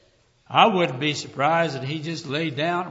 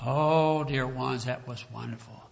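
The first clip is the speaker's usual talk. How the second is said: ordinary talk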